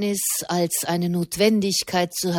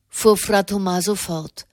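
usual speaking voice

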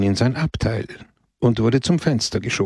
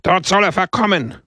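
shouted; 9 semitones higher than usual. A narrator's reading. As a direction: yell it gleefully. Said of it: "brüllte die Versammlung".

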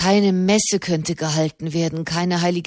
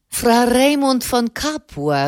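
calling the name out, slow and a little raised; 5 semitones higher, slower than usual. A narrator's reading. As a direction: call across to him, drawn out. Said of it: "rief Soderini". (laut und langsam rufen)

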